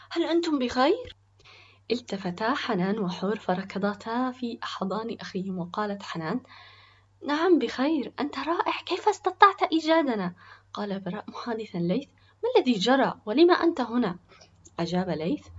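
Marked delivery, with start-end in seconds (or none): 0.1-1.2 high, louder anxious question; very worried. A narrator's reading. Brother finds his siblings at the hospital, very worried. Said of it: "بصوت ينم عن قلق كبير". 7.3-10.3 put-on high, loud, delighted young girl; excited relief. Little sister runs into her brother's arms, excited. 12.4-14.2 louder, raised, puzzled questions; urgent questioning. Acted. Worried brother questions his friend at the hospital, urgent.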